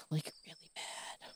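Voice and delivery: whispered really mad; a low whispered aside; under the breath. Real, not acted. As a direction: drop to a whisper on the last words. Emphasising anger after crying.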